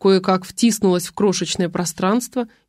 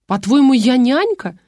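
higher, louder outraged retort; indignant anger; 5 semitones higher, 5 dB louder than usual. Acted. Husband indignantly refusing to wash the baby. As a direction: fire it back, offended. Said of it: "побагровел супруг".

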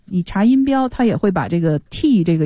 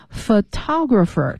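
photographer stressed on the second syllable, pho-TO-grapher; 2 semitones higher, slower than usual. Shown as a demonstration. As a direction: hit the TO in photographer. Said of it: "它的重音在涛第二个音节". (photographer 重音放在第二个音节)

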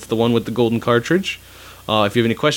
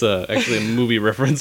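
ordinary delivery

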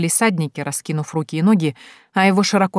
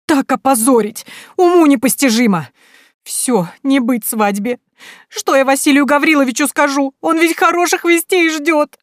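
high, loud wailing lament; lamenting merchant's wife; 8 semitones higher, 5 dB louder than usual. Acted. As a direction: wring your hands and wail. Weeping mother laments disgrace. (причитающая купчиха)

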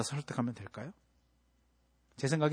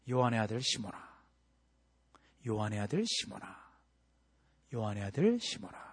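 soft, low, gentle call; tender calling; 7 semitones lower, 7 dB quieter than usual. Acted. Jesus restoring Peter by name, soft and low. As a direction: call his name softly, like restoring a friend. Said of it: "이렇게 부르십니다".

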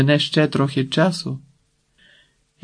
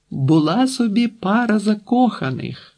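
higher-pitched calling out; pitched-up, half-shouted announcement; mild shout; 8 semitones higher than usual. A narrator's reading. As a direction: call it out over noise, without full force. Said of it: "закричала Марта".

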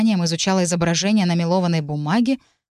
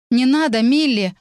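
loud, higher shout; 6 semitones higher, 4 dB louder than usual. A narrator's reading. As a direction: yell at her to stop. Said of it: "крикнула Эмма".

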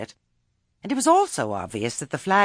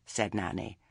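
ordinary delivery, nothing marked

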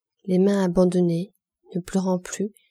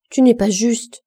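higher and louder protest; growing heated; 4 semitones higher, 7 dB louder than usual. A narrator's reading. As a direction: flare up against his insult. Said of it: "dit-elle en s'animant".

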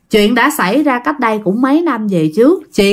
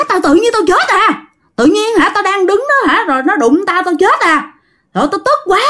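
high, agitated vengeful spirit voice; high-pitched ghost ranting about his death; angry ghost; 9 semitones higher than usual. Acted. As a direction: rant like a wronged dead man. Ghost speaks through the brother.

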